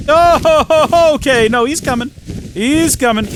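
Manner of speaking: high, loud laughing surprise; thrilled the dragon finally follows; a squeaky laughing oh, he's coming; delighted surprise. Real, not acted.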